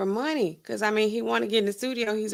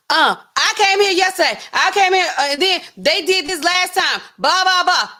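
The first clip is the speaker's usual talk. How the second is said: higher and louder, complaining customer imitation; pushy customer; 8 semitones higher, 5 dB louder than usual. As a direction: play the insistent customer, pressing hard. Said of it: "They like".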